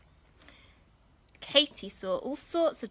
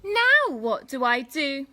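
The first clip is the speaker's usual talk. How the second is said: louder, slow and cross; lost Katy fed up, slow and loud; 4 semitones higher, 10 dB louder, slower than usual. Acted. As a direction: stamp it out, annoyed child. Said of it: "said Katy crossly".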